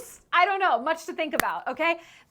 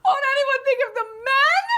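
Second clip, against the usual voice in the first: very high, loud fake-crying wail; hammed-up hysterical weeping; mock sobbing plea; 12 semitones higher, 7 dB louder than usual. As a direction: cry it out in melodramatic despair.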